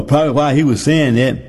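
normal talking voice

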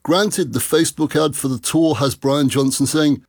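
ordinary talk

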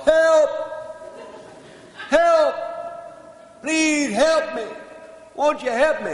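comic high-pitched yell for help; hollering for help. Acted. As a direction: holler desperately from across the farm. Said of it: "he heard this fella holler".

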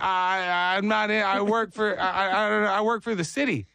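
imitated baffled worker, tripping over words; flustered stammer. Confused city worker answering odd questions, stammering. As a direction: stumble and restart as if caught off guard.

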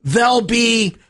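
high, slow sarcastic disbelief; mock astonishment. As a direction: ham up the shocked discovery. Mock shock at obvious tip.

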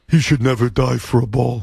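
low, flat monotone imitation; low monotone Ernie. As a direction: drone it out low and even. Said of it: "I'll do an Ernie imitation, he had a very low monotone voice".

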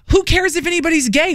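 high-pitched, incredulous parent; imitating a baffled, exasperated parent; retold exasperation. Retold parent exasperated at her kids.

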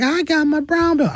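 put-on high, boastful child voice; little karate kid showing off his belt; bragging little kid. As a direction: brag like a proud seven-year-old.

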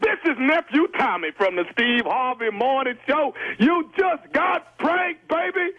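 high, drawn-out showman's delivery; big radio-host reveal; prank reveal announcement. Real, not acted. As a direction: announce it triumphantly, pitch soaring.

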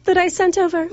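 mock-British interviewee; fake English accent. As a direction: ham up a posh English accent. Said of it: "surprised by your accent".

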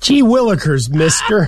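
put-on gee-whiz kid, bright and eager; wholesome 1950s kid. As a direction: play an innocent 1950s youngster. Joking about old-fashioned exclamations.

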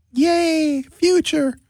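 put-on high, gleeful kid's cheer; high child's yay. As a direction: cheer like a kid who just got good news. Kid voice cheering.

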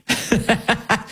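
soft knowing chuckle; a brief amused laugh. Real, not acted. Host laughing at the caucus's motive, soft chuckle.

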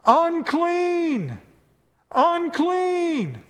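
high ringing call of unclean; shouted warning cry, high and stretched; leper's cry. Retold or imitated. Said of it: "you had to yell out".

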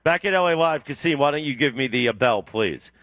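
usual speaking voice

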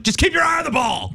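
shouting coach. Pretend. Golf advice yelled.

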